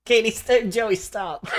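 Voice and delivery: ordinary delivery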